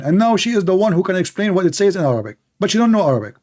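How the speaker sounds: normal talking voice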